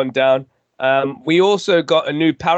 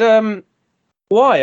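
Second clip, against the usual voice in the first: high, louder puzzled why; quizzical doctor; 6 semitones higher, 4 dB louder than usual. Retold or imitated. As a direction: ask why in disbelief. Retold doctor's reply.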